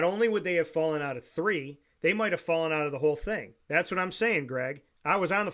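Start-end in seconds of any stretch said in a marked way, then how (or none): none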